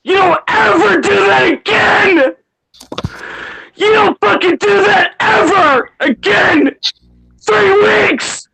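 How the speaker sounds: very high-pitched enraged scolding; furious screaming. Real, not acted. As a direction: scream it, at the end of your patience. Furious at friend over three weeks' absence.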